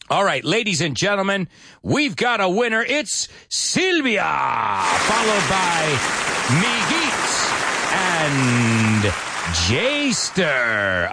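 hammed-up winner announcement with drawn-out names; announcer. Teacher announcing game winners to the class, showman announcer voice. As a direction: stretch each name like a ring announcer.

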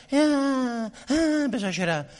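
normal talking voice

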